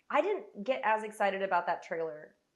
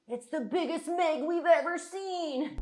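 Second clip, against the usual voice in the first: slow, higher dramatic trailer voice; trailer announcer; 8 semitones higher, 5 dB louder, slower than usual. Acted. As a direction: sell it like a blockbuster trailer.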